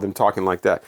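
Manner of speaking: ordinary delivery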